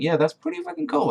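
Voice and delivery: gushing fan reaction; high-pitched, thrilled praise; excited approval. Retold or imitated. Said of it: "And I was like".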